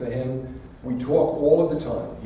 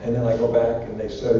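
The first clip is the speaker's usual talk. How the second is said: ordinary talk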